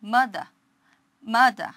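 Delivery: mother ending in ah with no R, mada; mother with the final R dropped; mother, end left open, R not rolled; British no R. Shown as a demonstration. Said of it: "R 발음 날립니다".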